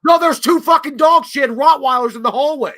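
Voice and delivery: almost yelling in disbelief; exasperated outburst. Real, not acted. Protesting, exasperated.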